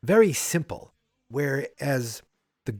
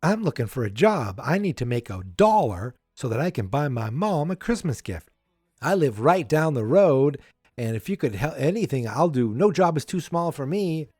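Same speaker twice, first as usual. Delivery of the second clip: lilting, higher-pitched imitation of a little boy; eager kid; 4 semitones higher than usual. Kid asking a neighbor for a job, mock-eager pitch. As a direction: pipe up like a kid pitching for work. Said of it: "too clever for a kid to have".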